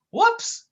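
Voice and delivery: very high cartoon whoops; goofy yelp. Sidekick tripping over his feet, goofy high yelp. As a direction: yelp as he trips. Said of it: "cried Dummerton".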